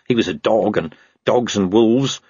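ordinary delivery

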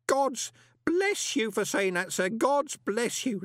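high, slow, overcome blessing; tearful gratitude. A narrator's reading. Grote thanks Moist for staying, tearful and fervent. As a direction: bless them as tears begin to come. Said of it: "Tears were beginning to roll down".

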